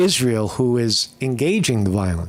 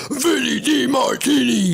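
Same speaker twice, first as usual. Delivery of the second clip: called-out name; 12 semitones higher than usual. Real, not acted. Calling out viewers' names.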